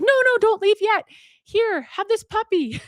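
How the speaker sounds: high, loud eager plea; urgent calling back. Retold or imitated. Grateful woman calling back old woman, urgent. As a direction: stop them leaving, hammed-up urgency.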